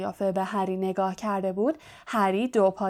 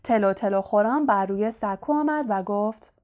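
usual speaking voice

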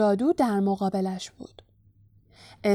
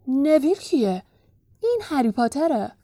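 higher-pitched frowning correction; frowning puzzlement; 4 semitones higher than usual. A narrator's reading. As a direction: question it with a frown. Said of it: "ابروهایش را در هم کشید و گفت".

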